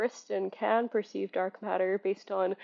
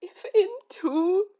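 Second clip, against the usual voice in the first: choked-up; 8 semitones higher, 5 dB louder, slower than usual. Real, not acted. Emotional rant.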